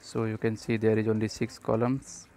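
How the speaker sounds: usual speaking voice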